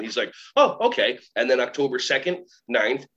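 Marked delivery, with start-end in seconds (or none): 0.6-1.2 higher, louder "oh"; surprised agreement, retold; mildly startled reply, imitating him; taken aback. Retold reply to bold request.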